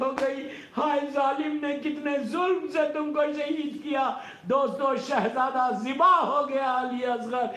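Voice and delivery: high, loud wailing sermon climax; preacher crying out in mourning; impassioned lament. Real, not acted. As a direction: build the grief to a piercing peak.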